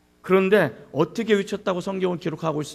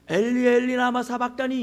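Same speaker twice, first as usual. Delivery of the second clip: "엘리, 엘리" lifted higher, lightly called; raised but unforced call; 4 semitones higher than usual. Shown as a demonstration. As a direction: call "라마 사박다니" up high without force. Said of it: "이렇게 하진 않았을 거예요".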